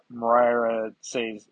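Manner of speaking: usual speaking voice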